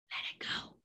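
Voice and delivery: breathy whispered let it go. Real, not acted. To her viewers, gently whispering to let it go. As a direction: whisper it, letting it drift away.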